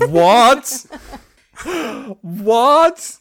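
loud, high incredulous what; shocked disbelief. Real, not acted. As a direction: yelp out in disbelief. Hearing absurd car name.

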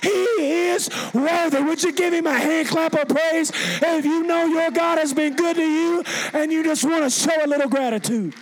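high, loud shouted praise; preaching at full force. Real, not acted. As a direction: shout it out to rouse the congregation.

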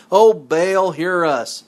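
slow, loud call of "O Baal", drawn out; a pleading call to a silent god. Acted. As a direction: cry out to Baal as the priests did. Priests calling on Baal.

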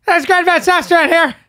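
high, loud mock TV-host voice; Greta Van Susteren. Host mocking a cable news anchor, high loud impression. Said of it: "the way she looks or her voice".

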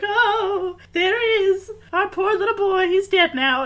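high, loud mock lament; mock mourning. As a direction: wail theatrically like a grieving bird congregation. Voicing mourning birds at a funeral.

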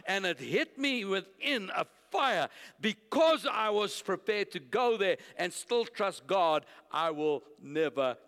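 loud, high declaration of faith; impassioned preaching. Real, not acted. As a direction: preach it at full force, punching the key words.

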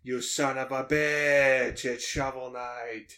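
slow, drawn-out mobster scolding; mock mobster. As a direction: stretch the curse like a mob boss. Playing mobster scolding Christopher.

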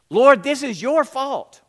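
loud, high, hurling the blame; angry accusation. A narrator's reading. Martha to Jesus after her brother's death, loud accusing outburst. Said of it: "Martha came out and attacked him".

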